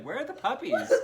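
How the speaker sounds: laughing while mimicking her high-pitched ask; mock high voice. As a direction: squeak it out like a silly question.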